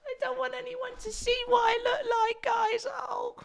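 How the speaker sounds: very high, slow mock whiny voice; Skull Thuggery voice. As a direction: whine like a shy masked villain. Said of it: "this is now Skull Thuggery coming in".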